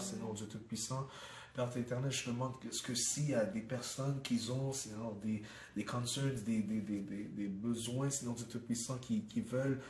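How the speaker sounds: steady, reverent prayer voice. Acted. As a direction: pray for the listeners, unhurried. Leads a closing prayer.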